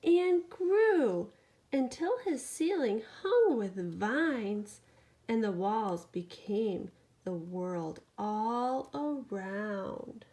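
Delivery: lively bedtime storytelling; animated picture-book reading; reading to a child. A narrator's reading. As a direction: read it to a sleepy toddler. Reads bedtime story aloud.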